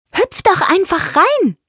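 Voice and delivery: high, lively bird voice; high-pitched carefree little bird; chirping bird. A narrator's reading. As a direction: chirp an eager invitation. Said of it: "zwitschert Froni".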